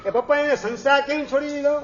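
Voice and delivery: put-on high, quick childlike question with a laugh in it; curious little son, laughing. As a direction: play the boy pestering his father, amused. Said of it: "નાનો છોકરો છે". (હસતાં હસતાં નાના દીકરાનો ઊંચો સવાલ)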